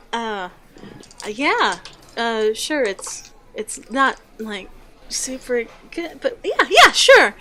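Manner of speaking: awkward barmaid stumbling into an eager yes; hesitant start rising to high, chuckling eagerness; young barmaid, hesitant then eager. Acted.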